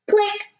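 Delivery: high bright click sound; camera click. A narrator's reading. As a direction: snap it like a camera.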